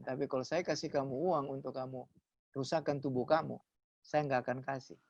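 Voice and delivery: calm and firm, staring them down; quiet firm warning. Retold or imitated. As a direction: pastor quietly refusing the thugs. Pastor firmly facing extortioners.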